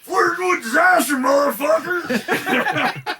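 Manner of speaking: high shouted troll rant. Acted. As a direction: scream it like an outraged troll. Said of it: "Your troll voice is fucking perfect".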